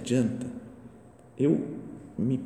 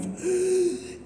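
sharp high gasp of shock. Retold or imitated. Neighbor gasps at a revealed sin, shocked.

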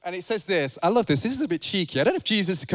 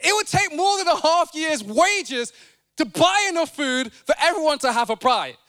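higher flustered disbelief, slowed down; exasperated Philip; 9 semitones higher, 3 dB louder than usual. Retold or imitated. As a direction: play Philip as baffled and overwhelmed. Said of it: "and Philip says".

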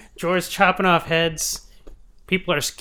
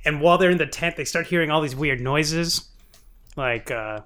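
ordinary delivery

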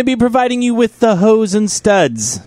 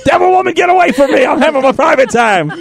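high, hammed-up cry of outrage; mock outraged shout; 9 semitones higher than usual. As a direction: shoo her off in mock horror. Retold shout at wife.

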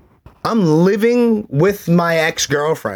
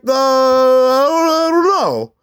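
high, drawn-out dopey hesitation; mock a clueless man searching his memory; oblivious stepfather impression; 11 semitones higher, 3 dB louder, slower than usual. Stepfather failing to recall the girl, mocked as oblivious. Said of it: "Ronnie Cigars is half oblivious".

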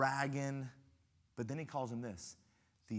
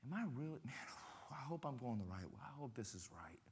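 much softer, half-whispered worry; doubting whisper; 11 dB quieter, faster than usual. Retold or imitated. Lost walker doubting his path, whispering to himself. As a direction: murmur it to yourself, unsure.

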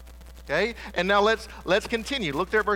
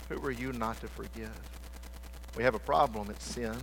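much softer, lower, intimate appeal to the room; soft earnest preaching; 5 semitones lower, 7 dB quieter than usual. Acted. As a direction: bring it down close, pleading gently. Soft appeal to congregation on forgiveness.

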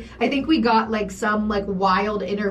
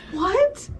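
high, slow mock-shocked mom voice; 11 semitones higher, slower than usual. Friend imagining the mother's horror, high mock shock. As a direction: play the horrified mother.

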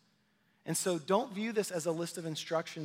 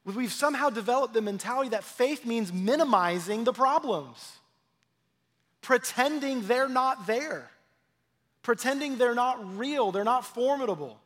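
ordinary talk, nothing marked

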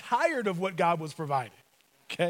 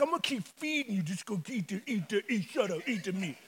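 muttered scolding; 7 dB quieter than usual. Acted. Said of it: "he's like".

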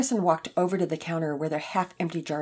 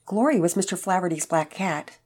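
plain reading voice

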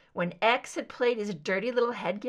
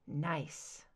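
low, soft murmur; quiet mocking murmur; 7 semitones lower, 9 dB quieter than usual. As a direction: mutter it under your breath. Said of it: "Scully murmured".

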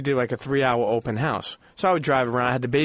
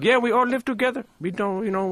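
put-on higher, light Indian accent; lightly voiced Indian neighbor explaining; 8 semitones higher than usual. Neighbor explains the block to the father, light Indian accent. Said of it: "do this in the Indian voice".

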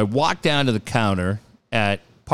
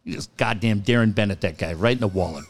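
normal talking voice

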